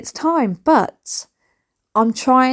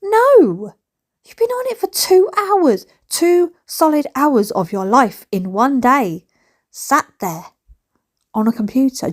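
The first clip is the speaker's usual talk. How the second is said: scolding mother; 4 semitones higher than usual. Retold or imitated. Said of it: "And I'm like".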